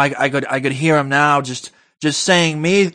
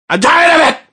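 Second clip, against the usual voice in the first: ranting shout; 10 semitones higher, 3 dB louder, faster than usual. Acted. Ranting outburst.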